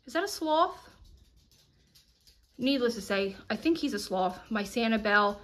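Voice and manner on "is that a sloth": a startled, amused question; suddenly spotting something odd; surprised. Real, not acted. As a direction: pitch jumps high in mock surprise. Surprised by a decoration.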